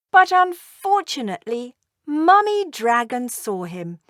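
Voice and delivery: usual speaking voice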